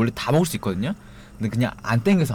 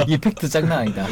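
loud laugh into a comment; 5 semitones higher, 4 dB louder than usual. Real, not acted. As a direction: burst out laughing, then speak through it. Laughs at the monkey brain fact. (크게 웃으며)

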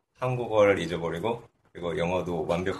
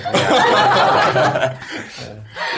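laughing; 4 semitones higher, 9 dB louder than usual. Real, not acted. Guest laughs.

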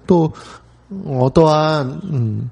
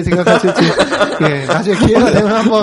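louder, giggling through the line; laughing; 5 semitones higher, 4 dB louder than usual. Real, not acted. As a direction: laugh while you talk. Laughing mid-sentence.

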